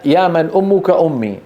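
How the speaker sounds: ordinary talk, nothing marked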